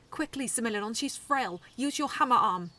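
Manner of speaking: loud urgent battle orders; shouting commands. A narrator's reading. Koji urging Similidon to attack, shouting. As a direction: yell it across the arena.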